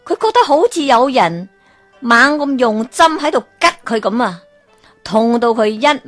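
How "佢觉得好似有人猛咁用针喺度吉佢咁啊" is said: usual speaking voice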